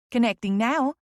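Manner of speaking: lilting Southern secretary; southern sing-song. A narrator's reading. Secretary connecting the caller, Southern sing-song. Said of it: "in her southern sing-song voice".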